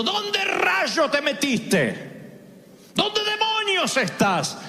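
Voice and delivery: high, slow angry calling out; angry demanding God. Retold or imitated. Preacher imagines God shouting for Adam, angry. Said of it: "la inflexión de voz".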